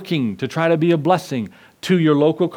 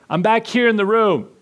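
raised, high call from the back room; little brother calling out; 7 semitones higher than usual. Acted. Little brother trapped inside calls to his older brother, high and urgent. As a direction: call out to be found through the smoke.

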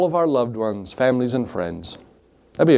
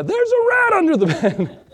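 much higher, squealing alarm with a laugh breaking in; panicked yelp. Retold or imitated. Pastor retelling spotting the desert rat, high panicked squeal. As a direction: shriek the news in mock panic.

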